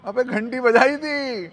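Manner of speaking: high, loud, slow exclamation; ज़ोरदार जवाब. Retold or imitated. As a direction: call it out in drawn-out mock exasperation. घंटी के सवाल का जवाब. (खींचकर ऊँची आवाज़ में)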